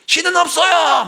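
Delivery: put-on high, loud defiant child's yell; a child provoking an adult with a loud shout. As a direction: yell it back like a cheeky kid. Child defiantly yells at the pastor's wife.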